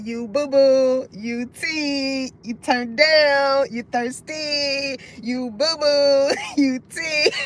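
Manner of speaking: slow, drawn-out rap quote. Retold or imitated. Reader quoting the [name] line slowly, mock rap. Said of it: "y'all know when he be like".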